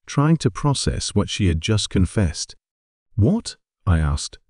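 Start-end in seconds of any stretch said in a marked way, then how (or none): 3.1-3.6 higher, stunned single-word question; dumbfounded. A narrator's reading. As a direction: ask it in sheer disbelief.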